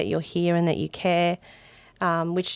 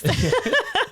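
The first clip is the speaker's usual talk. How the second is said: high laugh at own croaky voice; 13 semitones higher than usual. Real, not acted. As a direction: burst out in a high-pitched laugh.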